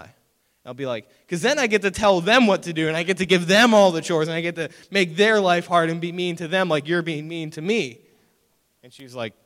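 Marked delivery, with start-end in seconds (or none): 1.3-8.0 loud, raised-pitch retort; hammed-up childish defiance to mom; mock vengeful kid. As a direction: throw it back with indignant relish. Acts out defiant kid answering mom.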